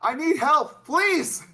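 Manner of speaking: high, loud plea for help; man down shout. Acted. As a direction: cry out desperately for help. Calling for a medic in battle.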